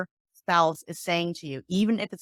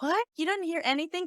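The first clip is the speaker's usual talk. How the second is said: high, incredulous question; shocked disbelief; 9 semitones higher than usual. Retold or imitated. Speaker shocked the listener heard nothing, incredulous. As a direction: act stunned that nothing was heard.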